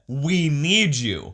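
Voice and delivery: slow plea. Retold or imitated. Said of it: "Christopher Daniels said".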